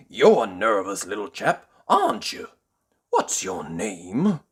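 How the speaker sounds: put-on gentle, amused old tramp; kindly tramp. A narrator's reading. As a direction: coax him kindly. Tramp calms Jelly.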